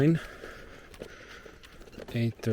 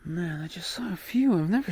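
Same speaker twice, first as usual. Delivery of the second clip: excited whisper; 6 semitones higher than usual. Real, not acted. Whispering near fireflies in dark.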